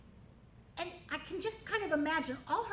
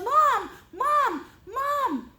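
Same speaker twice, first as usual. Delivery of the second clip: much higher and louder call for mom; yelling; 12 semitones higher, 11 dB louder, much slower than usual. Acted. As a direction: shout for mom from the doorway. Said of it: "and she yells".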